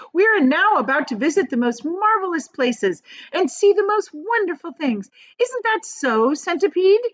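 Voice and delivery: high, loud, eager cry; cried out. A narrator's reading. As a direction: thrill at the adventure. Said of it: "she cried".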